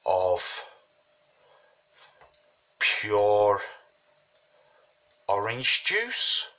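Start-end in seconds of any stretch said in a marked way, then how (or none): none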